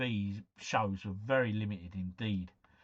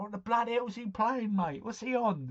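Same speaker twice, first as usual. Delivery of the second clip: nosy neighbour; 8 semitones higher, 4 dB louder than usual. Retold or imitated. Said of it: "looking at you going".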